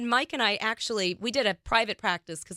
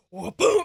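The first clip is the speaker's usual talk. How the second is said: imitated crash noise; 8 semitones higher, 5 dB louder than usual. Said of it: "he went".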